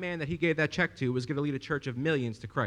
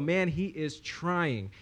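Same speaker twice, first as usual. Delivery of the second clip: mock judgment; much slower than usual. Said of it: "you would all go".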